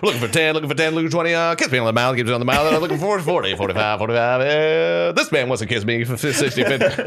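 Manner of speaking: auctioneer. Pretend. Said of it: "They're like".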